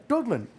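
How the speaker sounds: "Dublin" with a d for the b, "Dudlin"; puppet's mispronunciation. Acted. Puppet naming his hometown, "Dublin" said as "Dudlin". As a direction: say "Dublin" as "Dood-lin". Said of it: "say it like me".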